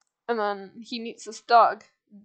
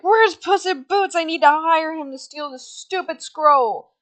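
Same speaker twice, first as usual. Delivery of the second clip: high, loud mock-angry demand; storming in; 7 semitones higher, 7 dB louder than usual. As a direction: demand it loudly as the retold intruder. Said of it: "Goldilocks storms in and she's like".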